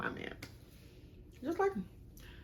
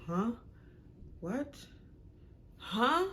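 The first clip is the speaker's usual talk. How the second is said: higher, playing dumb huh; mocking his dodge; 5 semitones higher, slower than usual. Mocking boyfriend who plays dumb when caught. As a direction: mimic a guy playing clueless.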